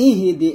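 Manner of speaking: ইহ দি with an extra I after the H; wrong three-syllable ইহ দি; common mistake. Shown as a demonstration. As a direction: say ইহ দি loosely as "ihi-di". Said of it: "এরকম বলি".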